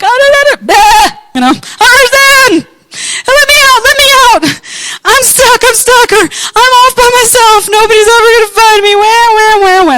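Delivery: high, loud, whimpering mock panic; whiny lost sheep. A lost sheep wailing for help in high whimpering panic. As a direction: whine like a helpless stranded sheep.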